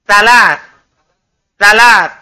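"Salat" as a firm "thalath"; TH, not S. Shown as a demonstration. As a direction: model "Salat" with tongue tip at upper teeth. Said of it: "Ujung lidah, ujung gigi atas". ("Salat" dengan bunyi TS lidah di gigi)

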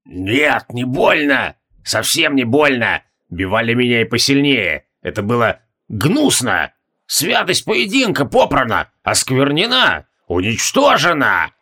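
fury held through clenched teeth; seething rage. A narrator's reading. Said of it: "Ригелион гневно сжал кулаки".

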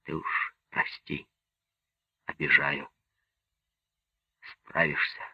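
soft, low, half-whispered; said into the ear. A narrator's reading. Commander privately apologising to Lyadov, said low into his ear. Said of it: "сказал на ухо".

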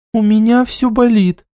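put-on whiny young woman; complaining.

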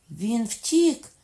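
high, loud, rising cry. A narrator's reading. Queen mother sees Henry escape, crying out. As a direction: exclaim it in dismay at full voice. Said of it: "скрикнула королева мати".

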